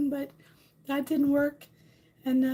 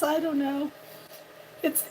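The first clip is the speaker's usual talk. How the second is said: usual speaking voice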